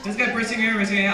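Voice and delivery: loud, high call to the crowd. Real, not acted. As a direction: call it out for the whole room. Calling out to the audience.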